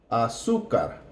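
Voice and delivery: azúcar with weight on the accented su; stress on u. Shown as a demonstration. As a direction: lean on su in azúcar. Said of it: "notice the U has the accent".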